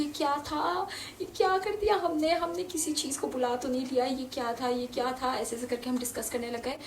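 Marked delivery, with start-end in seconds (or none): 0.0-5.3 scared. Retold or imitated. Scared kids whisper about it.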